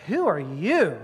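YOU swooping and drawn out; hostile stress on YOU. Shown as a demonstration. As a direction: look down on them with a long YOU. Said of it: "there is a negativity there, hostility there".

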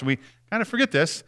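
usual speaking voice

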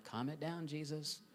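quiet, half-joking plea to tone it down; hushed aside. Retold or imitated. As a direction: murmur it like a worried PR handler. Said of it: "are being like".

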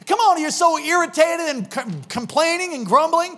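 high, loud impassioned exhortation; preaching at full force. Real, not acted.

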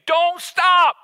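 sudden high, loud plea; begging shout. Acted. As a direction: cry it out, begging them not to quit. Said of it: "begging you".

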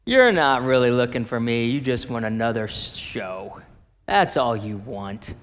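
low, soft, deflating let-down; wet-blanket downer. Acted. Jesus lets down the excited crowd, as a downer. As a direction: dampen their excitement, low and unimpressed. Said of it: "wet blanket. Like, what a downer".

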